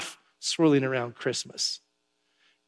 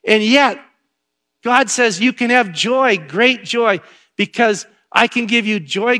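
loud, high preaching build; raised pulpit voice; 5 semitones higher, 5 dB louder, slower than usual. Acted. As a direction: preach it to the back row. Preaching at full force.

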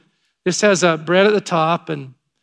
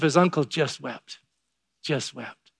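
tender hush; 3 semitones lower, 6 dB quieter, slower than usual. Real, not acted. Preacher recounts uncle weeping, moved.

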